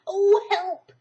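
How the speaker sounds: high, loud yelp from inside a jar; muffled cry for help. A narrator's reading. Pooh stuck in the honey jar, crying for help.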